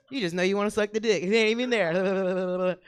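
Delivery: ordinary talk, nothing marked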